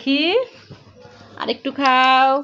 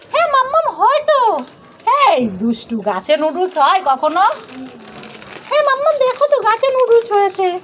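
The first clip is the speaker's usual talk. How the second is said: put-on high, sure little-kid voice; a kid swearing it's true; insistent child; 7 semitones higher than usual. Child insisting to mummy the tree grows noodles, high.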